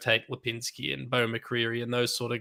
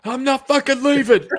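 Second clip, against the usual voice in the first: laughing mock scream, much higher and louder; Wolf of Wall Street yell; 13 semitones higher, 9 dB louder than usual. Echoing the co-host's joke, screaming laughing refusal. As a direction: shout it through laughter like a defiant broker. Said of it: "does a Wolf of Wall Street".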